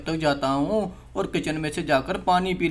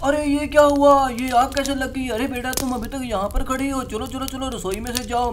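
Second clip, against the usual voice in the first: a man suddenly finding flames in the kitchen; shocked alarm; 6 semitones higher than usual. Acted. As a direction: react in a panic to the fire, hurrying the girl out. Man finds kitchen on fire.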